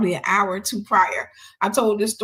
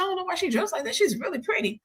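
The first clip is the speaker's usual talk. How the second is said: admiring reply; 10 semitones higher, 4 dB quieter, faster than usual. Retold or imitated. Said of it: "I said".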